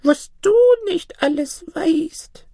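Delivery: flattering wonder, high and drawn out; amazed old granny. Acted. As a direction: marvel in a piping old woman voice. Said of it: "staunte das Mütterchen".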